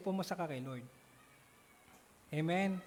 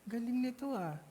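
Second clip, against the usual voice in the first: impressed wow; 6 semitones higher, slower than usual. Retold or imitated. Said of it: "Sabi ko".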